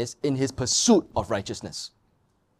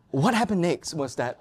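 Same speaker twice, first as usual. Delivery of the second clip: normal talking voice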